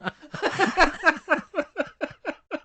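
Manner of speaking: high helpless laugh; laughing hard. Real, not acted. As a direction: crack up loudly. Reacting to dinosaurs in lab coats.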